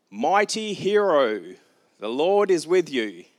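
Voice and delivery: slow, loud, solemn heavenly voice; drawn-out grand angelic greeting; booming angel. Acted. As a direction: proclaim it like a herald from above. Angel greets hiding Gideon.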